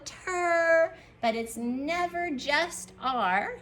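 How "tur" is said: TUR drawn out, high and loud; sounding out slowly. Shown as a demonstration. Teacher says it slow for pupils, stretched out. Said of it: "I'm gonna say it slow".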